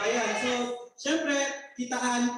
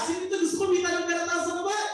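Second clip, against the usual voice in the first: hyping the crowd; 8 semitones higher than usual. Real, not acted. Hyping up the live audience.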